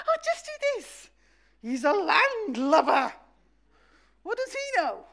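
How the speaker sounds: mocking the landlubber. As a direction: scoff at the know-it-all on shore. Fishermen mock the landlubber.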